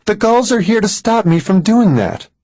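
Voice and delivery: very high, urgent woman's voice; agitated Mary. A narrator's reading. Woman warns Max about the gulls, agitated. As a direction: insist in a high, distressed pitch.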